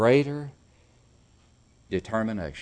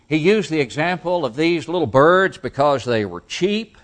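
raised, loud pulpit voice; forceful preaching; 6 semitones higher, 8 dB louder than usual. Acted. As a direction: preach it out, pitched high.

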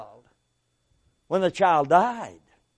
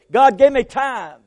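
loud plea; 4 semitones higher, 7 dB louder than usual. Acted.

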